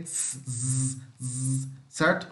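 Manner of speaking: soft hissed s then buzzing z on "s, z"; quiet s, then a z buzz. Shown as a demonstration. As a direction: contrast a voiceless s with a voiced z.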